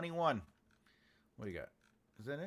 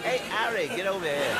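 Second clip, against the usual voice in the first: playful put-on accent, higher and louder, with a laugh; laughing fake-accent shout to Harry; mock accent; 7 semitones higher, 15 dB louder than usual. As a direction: call out to Harry in a hammed-up accent. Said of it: "pronounce it with, like, an accent".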